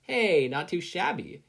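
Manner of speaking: lightly voiced praise, drawn out; complimenting. A narrator's reading. Kathleen nudging Inko while praising her, slow and loud. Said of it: "Kathleen complimented".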